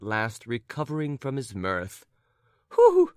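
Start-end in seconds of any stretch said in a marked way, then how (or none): 2.7-3.1 much higher, loud eager who; eager surprise. A narrator's reading. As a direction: blurt it out. Lady eager asks.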